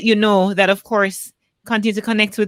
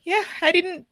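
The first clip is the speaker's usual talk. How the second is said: crying; 8 semitones higher than usual. Real, not acted. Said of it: "ended this conversation crying".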